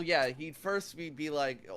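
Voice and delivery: usual speaking voice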